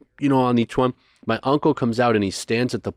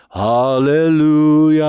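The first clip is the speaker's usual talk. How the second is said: high, loud, slow hallelujah; imitated preacher; 5 semitones higher, 6 dB louder, slower than usual. Preacher uncle crying out hallelujah to the room. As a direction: hold it out over the crowd. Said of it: "he's like".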